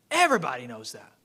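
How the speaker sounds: mock certainty, dry and ironic; sarcastic. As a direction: say it like everyone should know, ironically. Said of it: "I'm being sarcastic".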